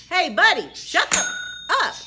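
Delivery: mock exasperated outburst; imagined snap. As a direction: tell the kid off in your head. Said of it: "you know I didn't say that".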